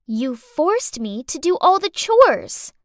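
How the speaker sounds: put-on accusing little girl.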